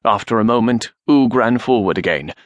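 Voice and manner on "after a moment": ordinary delivery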